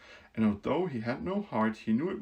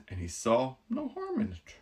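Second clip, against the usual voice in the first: plain reading voice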